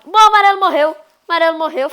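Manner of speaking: high loud disbelief; loud dismay. Real, not acted. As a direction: announce the loss in shock.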